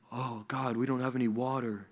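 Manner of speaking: soft, grumbling imitation; mock complaining. Israelites to God about water, mock complaint. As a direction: grumble it under a sigh, quietly. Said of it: "And they start complaining".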